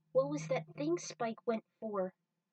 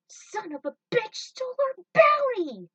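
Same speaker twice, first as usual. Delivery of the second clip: much higher, very loud outburst; furious shout; 13 semitones higher, 12 dB louder than usual. Acted. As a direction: yell at the thief flying off. Crew robbed of bounty.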